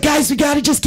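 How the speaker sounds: high, urgent pep-talk voice; rally the group, pleading and pushing; rallying the troops. Retold or imitated. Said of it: "rallying the troops".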